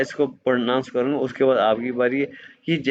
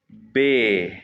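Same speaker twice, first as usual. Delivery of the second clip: normal talking voice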